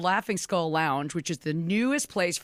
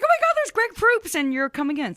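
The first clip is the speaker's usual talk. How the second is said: much higher, louder gasp of delight; excited surprise; 10 semitones higher, 5 dB louder than usual. Retold or imitated. Host retelling spotting Greg on TV, excited surprise. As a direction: squeal at spotting him on screen.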